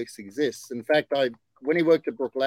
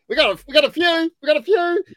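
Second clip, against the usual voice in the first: high, louder, quickfire cut-in; excited interjection; 12 semitones higher, 7 dB louder, faster than usual. Real, not acted. Host cutting in to boast of book stock, excited interjection. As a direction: jump in high and loud, repeating yourself.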